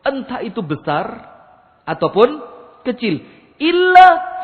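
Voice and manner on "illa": sudden loud, high "Illa"; emphatic cry. Real, not acted. Preacher stresses the exception to his listeners, loud and high. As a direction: fling the word out hard.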